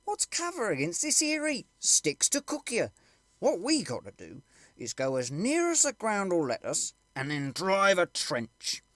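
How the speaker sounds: a common soldier arguing his point, slow and insistent. Acted.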